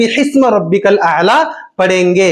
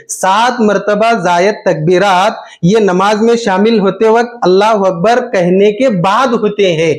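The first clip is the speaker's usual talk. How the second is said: usual speaking voice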